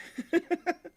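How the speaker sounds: quick high burst of a laugh; a real amused chuckle; short laugh. Real, not acted. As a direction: laugh at your own joke about Joe. Teasing Joe about calling in.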